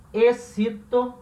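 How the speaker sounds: excito said slowly, es-SI-to; slow suffix. Shown as a demonstration. Teacher spelling out the ending excito, slow. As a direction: draw out excito. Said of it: "eu posso adicionar".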